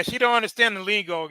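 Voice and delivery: usual speaking voice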